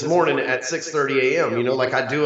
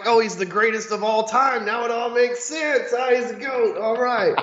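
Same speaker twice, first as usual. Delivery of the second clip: mock realization; 7 semitones higher than usual. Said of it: "We're like".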